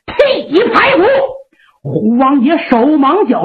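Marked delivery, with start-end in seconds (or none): 0.1-1.0 high shouted call; battle cry. Acted. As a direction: shout it up high as the axe swings. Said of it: "山大王还在喊".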